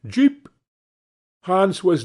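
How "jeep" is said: high, loud call of Jeep to spur the horse; cry out Jeep. Acted. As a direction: sing out the command sharply. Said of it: "and cry".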